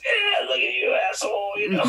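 high, loud mocking shout; hammed-up taunt; jeering jocks. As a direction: heckle like a jock picking on someone. Jeering jocks acted in story.

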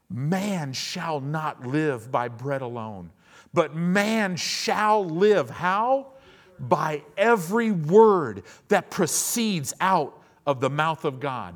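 slow, weighty proclamation swelling loud and high; declaimed scripture. Acted. Jesus answering Satan with the word, slow declamation. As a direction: proclaim each word slowly, building in force.

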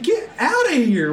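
half-laughing mock shout at the boater; exasperated yell. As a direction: shoo him off, laughing in disbelief. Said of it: "I'm like".

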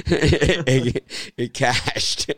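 high, slow words breaking into laughter; laughing. Real, not acted. Host tells friends his bet cashed, laughing. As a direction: laugh through the words as you boast.

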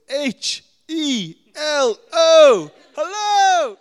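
slow, very loud, high spelled-out yell; screaming spelled hello. Retold or imitated. Teen spells hello to the parrot, screaming high and loud. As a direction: bellow each letter at the parrot. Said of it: "screamed at the top of your lungs".

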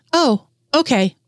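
lightly voiced relieved mother; relieved mom. A narrator's reading. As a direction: let the worry drain out slowly. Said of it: "sounding relieved".

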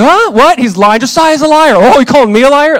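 loud, high acted quarrel; mad. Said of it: "I'm mad".

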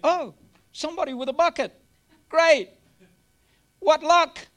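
high, loud mock delight at a lucky find; playful over-the-top glee. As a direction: sound thrilled at the luck, hammed up. Jesus voiced, excited at bucket.

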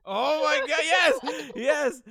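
overjoyed cheer; excited yes. Real, not acted. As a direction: burst out with delight at the idea. Asked to replay funny intro.

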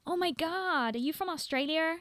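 mock an American fan in a bad American accent; a fan stopping her, in a bad American accent. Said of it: "my really bad American accent".

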